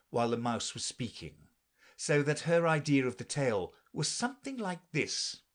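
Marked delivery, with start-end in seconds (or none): none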